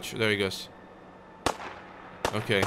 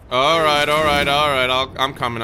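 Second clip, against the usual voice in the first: loud hurried reply; 4 semitones higher, 8 dB louder than usual. Real, not acted. Answering NPC call.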